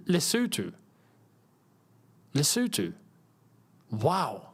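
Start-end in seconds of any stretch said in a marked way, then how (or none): 0.1-2.9 "Lesotho" as leh-SOO-too; actual pronunciation. Shown as a demonstration. Streamer practising the weird-sounding name, careful "Lesotho", leh-SOO-too. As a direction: repeat "Lesotho" with OO vowels, falling. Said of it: "That sounds weird".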